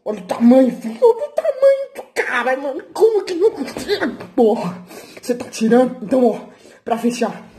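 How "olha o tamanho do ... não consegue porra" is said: excited brag. Real, not acted. Hyping himself up to viewers.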